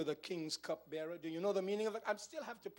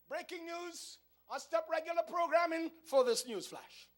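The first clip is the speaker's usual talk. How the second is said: higher, louder announcer voice, playing breaking news; mock news anchor; 12 semitones higher, 6 dB louder than usual. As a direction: cut in like a TV newsflash. Mock news bulletin interrupting sermon.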